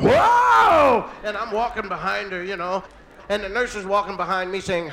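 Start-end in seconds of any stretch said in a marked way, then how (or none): 0.0-1.1 huge high drawn-out "whoa"; wife's labor yell. Retold or imitated. Husband retells his wife's first labor pain, huge high yell. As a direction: howl it out at full volume. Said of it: "And she's going".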